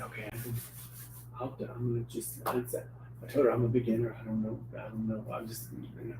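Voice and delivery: hushed, hesitant beginner; timid murmur. Retold or imitated. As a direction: mutter it like a nervous beginner. Nervous beginner admits uncertainty.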